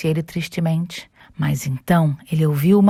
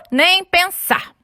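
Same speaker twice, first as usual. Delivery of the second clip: drawn-out refusal; 12 semitones higher, 3 dB louder, much slower than usual. Acted. Flat refusal.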